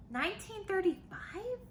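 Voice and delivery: high, rising amazement; wow, that long ago?; amazed. Real, not acted. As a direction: sound astonished at the date. Teacher marvels at the date.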